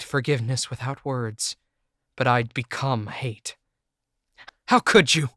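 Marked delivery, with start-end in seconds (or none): 4.7-5.3 higher, louder accusing shout. Acted. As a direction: throw the question at her, betrayed. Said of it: "I shouted".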